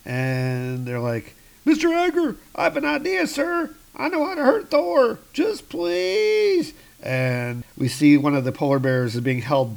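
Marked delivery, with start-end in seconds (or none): 1.7-6.7 very high, squeaky begging voice; pleading scientist. Pretend. Scientist pleading with Mister Agar, high and panicky. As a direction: beg for your life in a tiny high voice.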